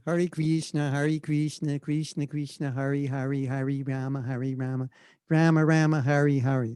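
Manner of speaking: American-accented chant with long "kreesh-na". Shown as a demonstration. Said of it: "like, an American would chant, kind of".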